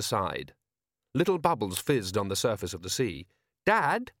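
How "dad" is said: high-pitched call to Dad; young son. Acted. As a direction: get father's attention in a high voice. Son interrupts to alert father.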